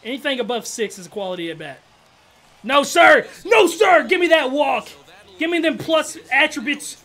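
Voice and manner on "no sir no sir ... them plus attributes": loud, high demand for the walk; hyped gamer yelling; excited shouting. Real, not acted. Begging for a walk.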